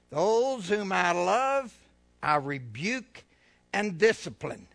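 slow firm preached quote; solemn scripture reading. Acted. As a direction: proclaim each phrase. Scripture read.

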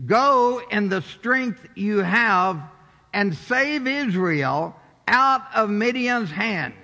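slow, loud, every word weighted; slow emphatic command. Shown as a demonstration. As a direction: lean hard on GO, slowly. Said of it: "Let me do it again".

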